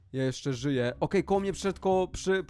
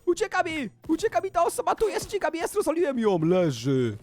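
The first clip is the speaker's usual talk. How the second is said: high-pitched, urgent excited calling; excited chase; 10 semitones higher than usual. Real, not acted. Player spots a hider who is getting away, excited high calls. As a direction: call out a frantic chase, voice jumping high.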